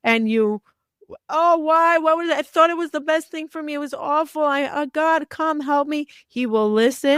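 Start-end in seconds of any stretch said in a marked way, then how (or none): 1.3-6.1 mock crying plea. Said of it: "you come crying and say".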